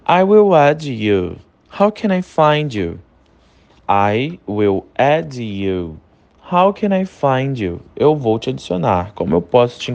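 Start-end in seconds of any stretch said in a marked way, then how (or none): 3.9-6.0 slow, careful teacher model; very slow and lower. Shown as a demonstration. Teacher repeats for students, very slow and lower. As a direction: spell it out slowly for learners, a bit lower. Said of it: "teacher repeats it for learners".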